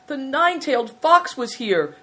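high-pitched, plaintive report; lightly voiced aggrieved innkeeper; self-pitying victim. A narrator's reading. Hailstone reporting to the prince, plaintive.

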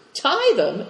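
high incredulous "Tie them?"; surprised exclaim. A narrator's reading. Captain baffled by the boy, sharp high question. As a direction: toss it back in disbelief.

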